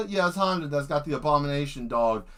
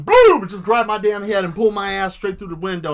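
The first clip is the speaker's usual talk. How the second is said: louder, blurting out the grab; sudden loud boom; 4 semitones higher, 8 dB louder than usual. Pretend. Imagining a robot snatching him, louder. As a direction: shout the attack as it hits.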